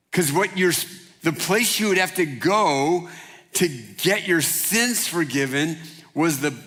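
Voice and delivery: ordinary talk, nothing marked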